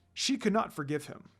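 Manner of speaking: plain reading voice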